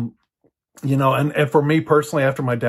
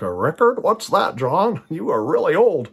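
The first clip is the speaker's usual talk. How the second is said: higher, lilting mock disbelief; puzzled young listeners; 4 semitones higher than usual. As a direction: lightly mimic baffled listeners teasing the speaker. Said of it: "Others are going like".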